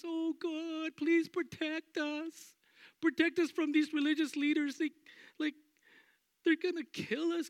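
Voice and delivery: high, whiny panicked pleading; mock fearful prayer. Church imagined praying in fear, high whiny panic. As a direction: beg God like a scared child. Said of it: "Was it".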